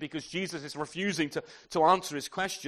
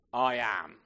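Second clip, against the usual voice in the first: low solemn divine voice; 5 semitones lower than usual. Acted. Jesus says the name.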